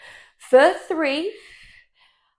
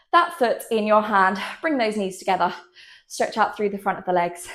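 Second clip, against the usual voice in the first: ordinary talk, nothing marked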